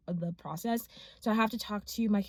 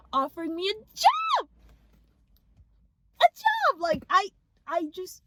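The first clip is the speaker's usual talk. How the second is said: thrilled disbelief; 10 semitones higher, 3 dB louder than usual. Real, not acted. Telling viewers she got the dream job, overjoyed. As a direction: voice jumping high and loud with joy.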